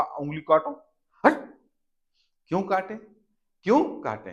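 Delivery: a pushy order then huffy why; mock indignant refusal. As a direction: act the child refusing, annoyed. Acted exchange: order and refusal.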